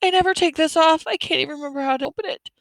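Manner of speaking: very high, rushed panic; frantic, high-pitched young girl. A narrator's reading. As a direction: gabble it in rising panic. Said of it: "Ellen said frantically".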